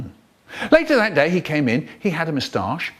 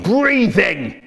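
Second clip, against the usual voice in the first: inspector's yell; 6 semitones higher, 3 dB louder than usual. Acted. Inspector yells at class.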